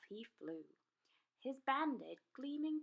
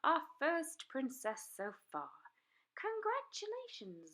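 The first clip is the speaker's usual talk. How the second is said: higher, louder congratulations; proud praise; 6 semitones higher, 4 dB louder than usual. A narrator's reading. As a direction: announce it proudly. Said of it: "said Madam Dragon".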